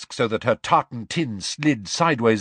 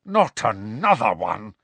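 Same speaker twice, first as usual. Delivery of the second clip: exasperated outburst; violent exclamation. A narrator's reading. Professor reacting to yet another decree, violent exclamation. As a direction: burst out in protest. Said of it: "exclaimed Professor McGonagall violently".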